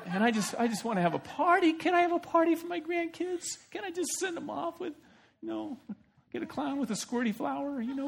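high, giggly, gushing party voice; fake sweet grandpa. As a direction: play innocent doting grandpa. Laban feigning jolly grandpa.

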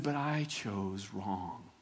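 low, soft and slow admission of fault; contrite confession. Acted. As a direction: confess quietly to God. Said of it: "should have stopped there and said".

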